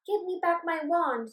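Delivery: loud, high demand for the wand back; insist, raising the voice; fairy demands her stolen wand back. Acted. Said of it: "Saffron demanded".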